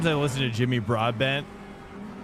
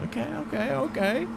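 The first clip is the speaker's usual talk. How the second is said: mock high okay; 12 semitones higher than usual. Reacting to a French Canadian.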